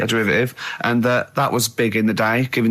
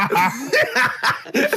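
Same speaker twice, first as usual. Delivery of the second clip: high laugh; 10 semitones higher than usual. Real, not acted. Laughs at Rasputin joke.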